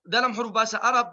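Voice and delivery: ordinary delivery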